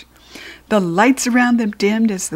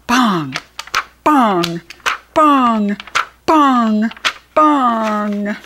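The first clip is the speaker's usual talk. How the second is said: sound effects; 3 dB louder, much slower than usual. Acted. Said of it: "Here we go".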